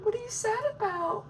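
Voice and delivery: high mock-concerned coworker; coworkers asking. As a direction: play a coworker gently asking. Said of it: "People be like".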